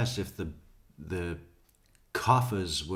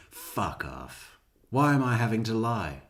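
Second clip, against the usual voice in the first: ordinary talk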